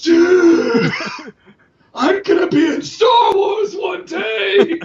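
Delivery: high, loud, laughing; excited shout. Real, not acted. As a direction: shout it with giddy glee.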